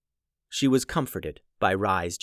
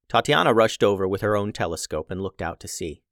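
ordinary delivery, nothing marked

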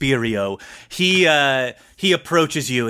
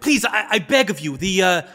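put-on higher-pitched, halting old courtier; old adviser, pleading; 7 semitones higher than usual. Old man approaches his lord, pleading nervously. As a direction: beg his lordship with fussy deference. Whisperio appeases the emperor.

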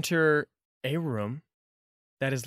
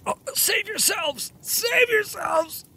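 desperate cry. Acted. Choked cultist cries warning.

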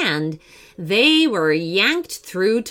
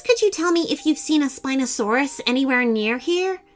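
put-on higher, courteous child's request; lightly voiced polite boy; 7 semitones higher than usual. Raphael asking a Stegosaurus for directions, lightly polite. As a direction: ask the big creature nicely.

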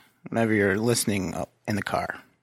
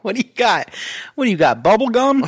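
higher, louder teasing questions; mocking paparazzo; 10 semitones higher, 7 dB louder than usual. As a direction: badger him about flavors.

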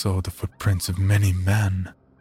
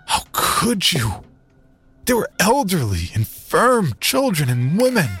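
outraged; 9 semitones higher than usual. Acted. Narrator confronting his brothers, high and outraged. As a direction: demand an answer, appalled.

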